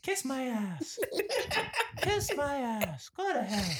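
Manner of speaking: high, drawn-out mocking insults; Jackie. Cashier's favorite comeback to boss, high mocking imitation. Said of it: "Her favorite thing is".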